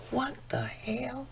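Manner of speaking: high, slow, soft disbelief; stunned whisper. Real, not acted. Woman amazed he asked about her asthma pump, stunned whisper. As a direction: breathe it out in shock. Stunned by his caring question.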